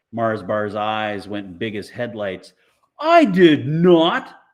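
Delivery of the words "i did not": high, loud, very slow denial; protest, wide-eyed and caught; indignant denial. Acted. Mars Bar denies ripping Amanda's book, loud high protest.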